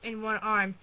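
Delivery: ordinary delivery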